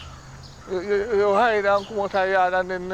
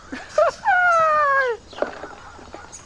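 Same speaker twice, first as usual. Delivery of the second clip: high laugh. Real, not acted. Laughing after bouncing game.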